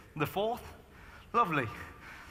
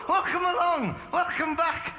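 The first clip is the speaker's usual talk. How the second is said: laughing welcome; 8 semitones higher than usual. Real, not acted. Greeting latecomer, laughing.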